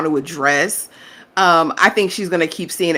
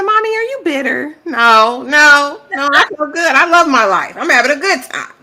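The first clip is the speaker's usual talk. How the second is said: higher, bright mock voices; imitating mother and daughter; 8 semitones higher, 4 dB louder than usual. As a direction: act out both. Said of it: "She said".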